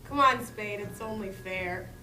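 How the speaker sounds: ordinary talk, nothing marked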